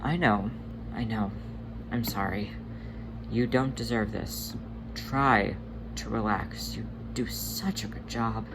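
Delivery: soft, hushed reassurance; calm him down gently; tender, quiet comforting; soothing comfort. Acted. Tugger comforting crying brother.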